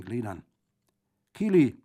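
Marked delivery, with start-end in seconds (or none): 1.3-1.8 put-on high, loud call to a child. A narrator's reading. Bandit calls the little girl, loud and high. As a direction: shout the name to fetch her over. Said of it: "rief Brandolaccio".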